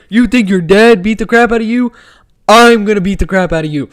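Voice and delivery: loud, high imitation of press-conference trash talk; mock fighter's taunt, pushed up and punched out; Dricus du Plessis. Fan imitating a fighter goading his opponent, loud cocky taunt. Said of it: "Dricus du Plessis over here".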